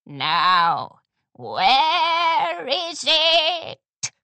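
loud, slow rising hag demand; demanding crone. A narrator's reading. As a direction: drag the question out menacingly. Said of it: "the Oldham pony demanded".

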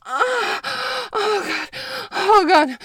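high, loud climactic cries; loud high-pitched cries; loud climax moans. Acted. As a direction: let it break out at the peak. Climax moaning.

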